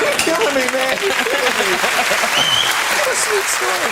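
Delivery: squeaky high voice of delight; high-pitched gush. Real, not acted. Host gushing to the guest about the story.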